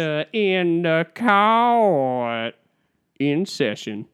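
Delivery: very long, belted courtroom call, high then settling; town-crier judge stretching every word; hammed-up, drawn-out proclamation to open court; Sandra Day O'Connor impression. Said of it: "Let, let me try it again".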